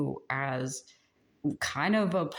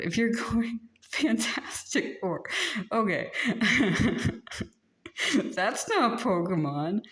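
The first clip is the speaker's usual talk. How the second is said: laughing; 6 semitones higher than usual. Real, not acted. Laughing at Fantastic Four mix-up.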